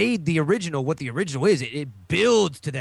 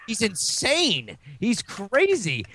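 ordinary talk, nothing marked

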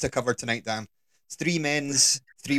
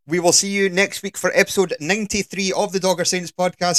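mock sign-off; 7 semitones higher, 5 dB louder than usual. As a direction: fake podcast outro, higher and louder. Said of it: "we're obviously taking the piss".

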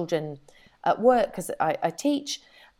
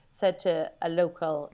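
normal talking voice

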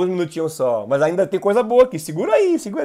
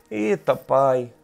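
lower, slow and softer, a drawn-out sigh; despairing sigh; 6 semitones lower, 5 dB quieter, much slower than usual. Shown as a demonstration. Anxious believer sighing to God, slow and low. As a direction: let it sink, slow and heavy. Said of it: "Oração".

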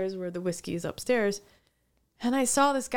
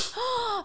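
high awed gasp; sharp gasp. Retold or imitated. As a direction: gasp at seeing him. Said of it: "I was like".

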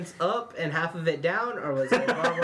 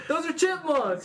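high, laughing correction; 9 semitones higher, slower than usual. Real, not acted. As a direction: laugh through the words.

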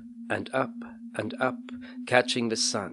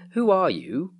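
put-on higher, swooping boy's demand; a startled boy, pitched up; startled questioning; 8 semitones higher, 4 dB louder than usual. A narrator's reading. Startled boy asks a stranger who he is, alarmed.

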